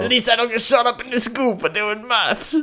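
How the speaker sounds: high, loud British clapback; hammed-up British retort; mock British accent. Mimics British comeback about Americans.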